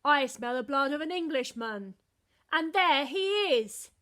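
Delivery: loud booming giant roar. A narrator's reading. Giant chasing Jack.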